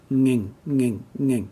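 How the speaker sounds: nging, nging, each starting straight on ng; si dropped. Shown as a demonstration. Teacher drilling learners after dropping si, initial ng. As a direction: repeat nging as short separate syllables opening with ng. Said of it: "try to drop the si part".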